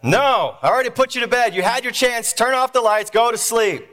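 high, exasperated refusal; dad yelling back. Acted. Father refusing his son at bedtime, yelling. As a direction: yell orders down the hall. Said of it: "Dad yells back".

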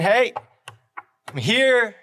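preacher voicing Jesus calling out at the door of your heart. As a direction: knock and call, hoping someone answers. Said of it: "Jesus is basically saying".

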